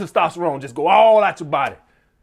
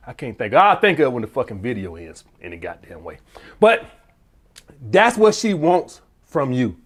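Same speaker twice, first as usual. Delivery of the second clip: ordinary talk, nothing marked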